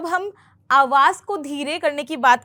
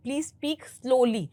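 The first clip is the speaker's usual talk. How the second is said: very slow please speak slowly; slow classroom example; slow demo; much slower than usual. Shown as a demonstration. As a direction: say it slowly as a model. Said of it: "तो हम कहेंगे slowly".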